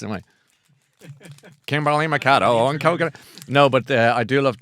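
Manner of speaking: ordinary talk, nothing marked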